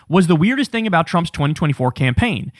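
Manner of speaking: ordinary delivery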